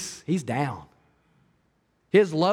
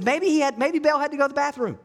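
much higher, teasing mockery; mocking taunt; 8 semitones higher, faster than usual. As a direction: poke fun at the idol's prophets. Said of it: "He's kinda mocking them a little bit".

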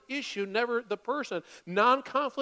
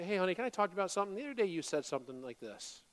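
soft, fast, calm and gentle; calm kind voice to a spouse; gentle opener; 3 semitones lower, 7 dB quieter, faster than usual. Shown as a demonstration. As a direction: ease into it kindly at dinner. Calm talk over dinner with spouse.

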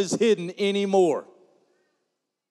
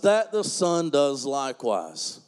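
slow, low; 9 semitones lower than usual. Shown as a demonstration. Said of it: "repeats verse line".

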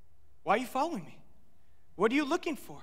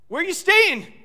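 much higher and louder, excited; eager blurt; 9 semitones higher, 8 dB louder than usual. Acted. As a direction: blurt it out like an overeager kid. Disciples' eager odd reply.

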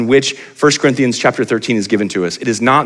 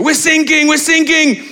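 much higher, urgent distress call over the radio; panicked British sailors; slower than usual. Retold or imitated. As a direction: cry mayday like a sinking sailor. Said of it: "the sailors go".

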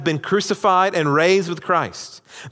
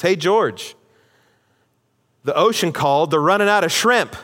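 acted teasing taunt; 4 semitones higher, 3 dB louder than usual. Acted taunt in Seinfeld retelling.

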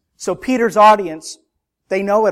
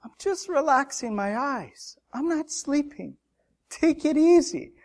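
higher, softer, defensive whine; mock excuse; 9 semitones higher, 4 dB quieter than usual. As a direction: play a sleepy believer brushing Peter off. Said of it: "We might just say".

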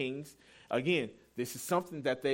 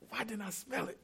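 ordinary talk

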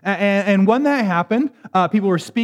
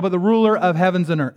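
ordinary delivery

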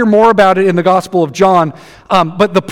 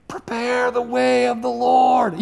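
a faint call from way off in the distance, slow; slow, far-off cry, much softer; faint distant voice; 3 semitones higher, 8 dB quieter, slower than usual. Shown as a demonstration. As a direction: sound like a voice from far out in the wilderness, barely heard. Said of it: "You can barely hear my voice".

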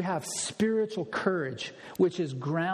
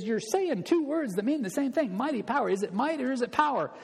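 higher-pitched, lightly voiced chiding wife; 7 semitones higher, faster than usual. Wife teasing her husband about his phrasing, higher voice. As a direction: play the wife poking fun at his phrase. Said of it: "his wife, Pat, is constantly on him".

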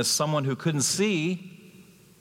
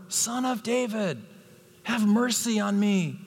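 crying out more; 9 semitones higher than usual. A narrator's reading. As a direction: beg Jesus as a blind beggar calling out. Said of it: "he kept crying out all the more".

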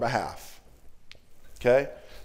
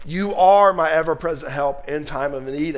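ordinary talk, nothing marked

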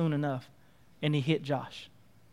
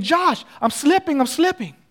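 urgent, raised voice of someone slipping; alarmed cry; 8 semitones higher, 5 dB louder than usual. Acted. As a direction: call out as your grip goes. Girl losing grip calls Josh.

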